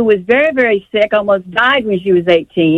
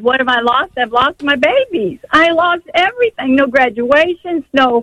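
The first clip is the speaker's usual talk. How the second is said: real hurt, stretching the words, pitch raised; anguished protest; 6 semitones higher than usual. Real, not acted. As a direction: plead your losses, voice lifted high. Caller protests all she has lost.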